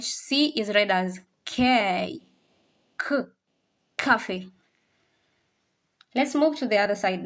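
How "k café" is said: ordinary talk, nothing marked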